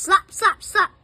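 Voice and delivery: slap sound effect. Acted. Machine hands slapping them away.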